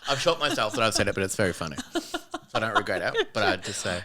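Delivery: talking through laughter; laughing. Real, not acted. Comic admitting they shocked themselves, laughing. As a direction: laugh at your own outrageous remark as you speak.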